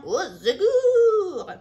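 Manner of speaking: high, slow mock wail; crying out. A narrator's reading. The fairy cries for help as she flees, wailing. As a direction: wail it like a caught villain. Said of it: "en criant".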